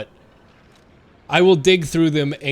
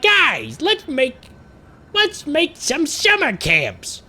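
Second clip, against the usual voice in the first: much higher, slow, sing-song suggestion; Godfrey voice; 10 semitones higher, slower than usual. Retold or imitated. Guilford Godfrey pitching camps to the guys, higher and slow. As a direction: pitch it up and draw it out cheerfully. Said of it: "He was like".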